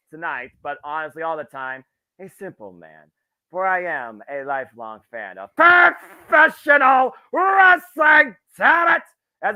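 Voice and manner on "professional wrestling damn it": much louder and higher, drawn-out showman shout; roared catchphrase. Real, not acted. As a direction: belt it out like a wrestling promo. Signature sign-off catchphrase, roared to audience.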